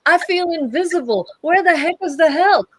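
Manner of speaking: high, loud hammed-up outcry; mock public complaint. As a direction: announce it to the whole bank as a joke. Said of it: "it would be funny if you went in there and go".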